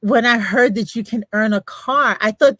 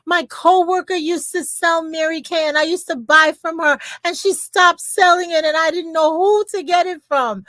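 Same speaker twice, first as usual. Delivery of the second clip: thrilled customer; 7 semitones higher than usual. Retold or imitated. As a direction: gush over the consultant like a fan. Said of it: "She said".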